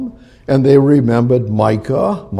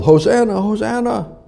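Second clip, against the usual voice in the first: crowd yelling; 10 semitones higher than usual. Retold or imitated. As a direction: call it out like a cheering crowd. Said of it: "They yelled".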